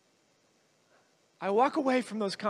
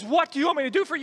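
rapid, high-pitched question; urgent question; 6 semitones higher, 5 dB louder, much faster than usual. Retold or imitated. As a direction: rush the quoted question out with raised energy. Same question, urgently repeated.

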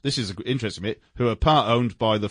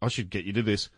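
ordinary delivery

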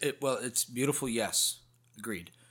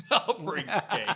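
high-pitched laughing repeat; 8 semitones higher, 3 dB louder, much slower than usual. Real, not acted. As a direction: echo it through laughter. Laughing echo of joke.

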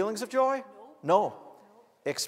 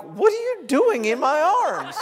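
higher, louder, drawn-out comic question; mock astonishment; 8 semitones higher, 5 dB louder than usual. Preacher recalls hugging a man at a hockey game, mock astonishment. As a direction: stretch it out in amused disbelief. Mock surprise in retold anecdote.

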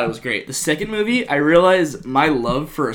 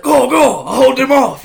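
high, loud brave call; hammed-up hero shout; heroic mock cry; 11 semitones higher, 9 dB louder than usual. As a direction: call it out like a swashbuckler. Quoting Puss heroic line.

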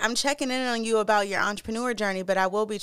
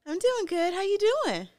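loud, high, cheerful hello; upbeat greeting, bright and high; excited greeting; 12 semitones higher, 5 dB louder than usual. Real, not acted. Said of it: "is that better".

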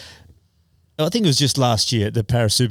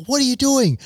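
shouted question. Retold or imitated. Retold rider shouting on ramp.

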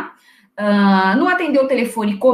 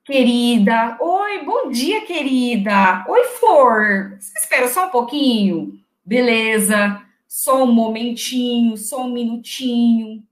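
imitando atendente melosa, arrastando as palavras; faça uma telefonista cheia de intimidade, esticando as vogais; atendente íntima demais; slower than usual. Shown as a demonstration. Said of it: "Vou falar expressões". (atendente melosa e arrastada)

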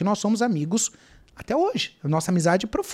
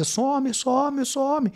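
retold shouting; 5 semitones higher than usual. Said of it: "Eu comecei a gritar dentro de casa".

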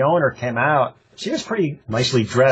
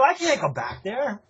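retold meek protest; 6 semitones higher, 4 dB quieter than usual. Acted plea to angry wife.

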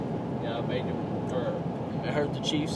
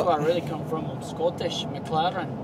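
lightly put-on Scottish brogue, a bit louder; joking Scottish accent, slightly louder; mock Scottish accent; 3 dB louder than usual. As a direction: claim Scottish roots in a playful Scottish accent. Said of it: "That's actually a good accent".

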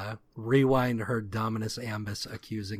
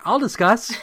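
high, loud, laughing eager blurt; a high, loud, laughing "I'll discuss"; eager Tailgate; 12 semitones higher, 10 dB louder than usual. Retold or imitated. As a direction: jump at the chance, laughing. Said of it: "Tailgate was like".

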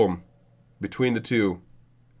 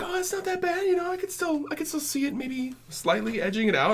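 much higher, softer, playing your past self; high mock hopeful voice; 6 dB quieter than usual. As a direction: squeak it out like a doubting fan. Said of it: "I was like".

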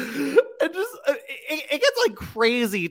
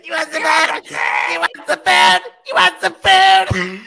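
mock yell; 8 semitones higher, 5 dB louder than usual. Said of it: "the whole time yelling".

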